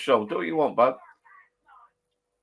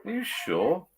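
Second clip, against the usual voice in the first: surprised; 10 semitones higher, slower than usual. Real, not acted. Doubting viewer's claim.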